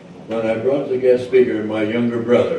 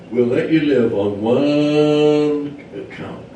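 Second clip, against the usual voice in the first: "one" stretched long and loud; drawn-out emphasis; 5 semitones higher, 5 dB louder, much slower than usual. Acted. Hunters set a condition for sparing mosquito, "one" drawn out.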